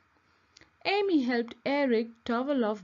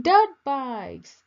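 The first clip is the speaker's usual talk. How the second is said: louder, eager burst; excited; 2 semitones higher, 8 dB louder than usual. A narrator's reading. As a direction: blurt it out with excitement.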